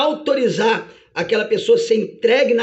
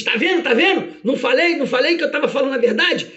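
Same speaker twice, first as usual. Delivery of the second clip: high-pitched, gloating accuser; excited, insistent I-told-you-so accusation; Satanás; 5 semitones higher than usual. Acted. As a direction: crow triumphantly to the judge as prosecutor. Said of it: "E aí Satanás diz".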